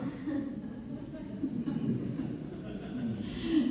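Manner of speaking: quiet airy chuckle; a small unvoiced laugh; amused breathy laugh; soft breathy laugh. Real, not acted. [name] amused by his letter.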